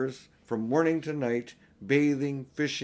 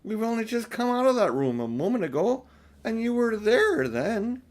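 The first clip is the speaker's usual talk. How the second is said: put-on higher, a touch impatient older sister; Susan, lightly voiced; 9 semitones higher, 4 dB louder than usual. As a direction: talk down gently to the little sister. Susan scolding Lucy.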